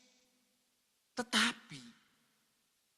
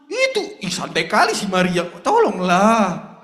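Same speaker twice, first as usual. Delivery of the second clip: annoyed Martha; 7 dB louder than usual. Acted. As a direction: beg Jesus with a huff.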